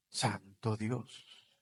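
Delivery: soft, drawn-out whispered exclamation; very slow hushed awe. Acted. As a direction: let it out in awe. (asombro susurrado y lento)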